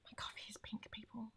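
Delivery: hushed whisper to viewers; secretive whisper. Real, not acted. Whispering about her pink coffee.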